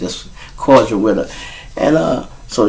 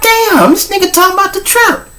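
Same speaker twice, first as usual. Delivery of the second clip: much higher mock woman's voice, amazed; imitating his lady friend; 3 dB louder than usual. Watching his video about their trip, pitched-up mock woman's voice. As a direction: pitch way up to play her watching. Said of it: "She like".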